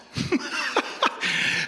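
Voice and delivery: high airy laugh; real laugh breaking through. Real, not acted. Laughing at own joke.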